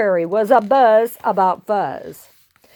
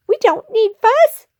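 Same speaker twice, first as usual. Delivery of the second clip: high, assured little voice; squeaky little hero; 13 semitones higher, 4 dB louder than usual. Acted. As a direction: declare it as tiny Pip. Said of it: "said Pip".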